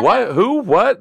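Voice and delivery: high, loud questions, each rising; baffled audience. Retold or imitated. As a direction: imitate a crowd thrown by an unknown name. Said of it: "The whole audience is".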